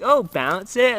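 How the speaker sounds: high, loud gleeful call; mock triumphant call. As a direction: crow it at the opponent. Player bouncing card triumphantly.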